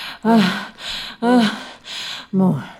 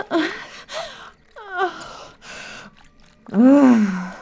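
climax moans; 4 semitones higher than usual. Acted. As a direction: ride the peak with broken moans. Climax moaning in role.

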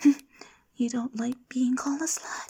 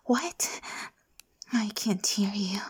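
ordinary talk

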